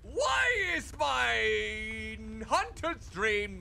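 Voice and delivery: slow, loud drawn-out outcry; shocked shout. Real, not acted. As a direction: call it out in disbelief. Shocked by burning nightmare.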